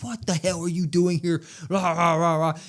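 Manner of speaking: mock a berating that dissolves into rah-rah noises; mock yelling. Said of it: "just yelling in his face".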